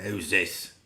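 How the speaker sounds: loud, curt Australian demand; angry Australian accent. Retold or imitated. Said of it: "thick, very angry, uh, Australian accent".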